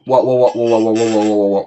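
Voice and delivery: loud excited wows; amazed wowing. Real, not acted. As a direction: shout wow over and over. Amazed exclamations in game. (émerveillé à voix forte)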